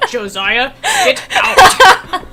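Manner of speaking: laughing fake order, ending high and loud; mock scolding. Joking how to send Josiah out, laughing mock scolding. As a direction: try scolding through the giggles.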